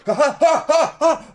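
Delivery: high, loud, pained "ha ha ha" cries; pained gasping cries. Retold or imitated. Woken by calf cramp, high loud pained cries. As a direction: cry out like waking in sudden pain. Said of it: "I just woke up going".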